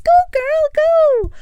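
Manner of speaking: high, loud cheering shout; crowd cheering. Retold or imitated. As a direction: egg her on like a crowd. Crowd pushing them to stage.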